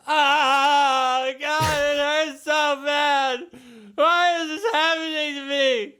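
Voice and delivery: very high, drawn-out agonized whine; hammed-up high wail of suffering; pained wailing. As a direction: wail in pain like a hurt prince. Voices the hurt prince's wailing.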